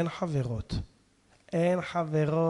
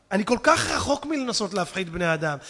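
ordinary delivery

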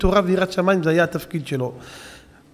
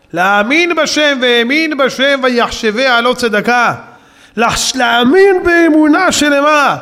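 fervent preaching; 6 semitones higher, 6 dB louder, slower than usual. Acted. Preaching faith forcefully.

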